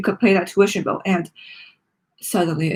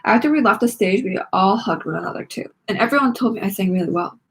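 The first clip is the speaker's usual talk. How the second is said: plain reading voice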